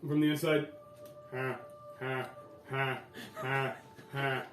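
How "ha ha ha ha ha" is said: slow, evenly spaced mechanical ha-ha; creepy robot laughter. Acted. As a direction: laugh like a broken robot, beat by beat. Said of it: "almost creepy robotic laughter".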